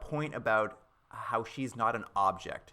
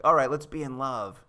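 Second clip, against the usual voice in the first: mock cheesy romance; 3 semitones higher, 7 dB louder than usual. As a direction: ham up a cheesy happy ending. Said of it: "They're like".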